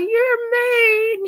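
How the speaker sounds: high, whimpering mock cry. Sister who got teased, in a high mock-crying voice. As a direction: imitate her teary hurt voice. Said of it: "She looked at me like".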